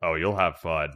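low-pitched mock British dad; proper fake British. Shown as a demonstration. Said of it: "proper fake British accent".

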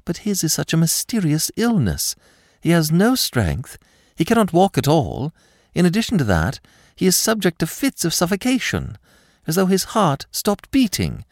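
high-pitched, worried daughter; anxious distress. A narrator's reading. Daughter describing her father's mysterious illness, anxious distress.

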